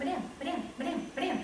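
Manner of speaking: put-on high, quick little-kid voice; little kids calling teacher. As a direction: imitate small children pestering "madame, madame". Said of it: "I've got little people".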